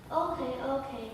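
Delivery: ordinary delivery, nothing marked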